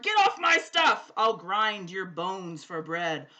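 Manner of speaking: booming, slow giant roar. A narrator's reading. The giant roars at Jack in the old story. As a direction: bellow it like a huge giant. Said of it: "A giant roared".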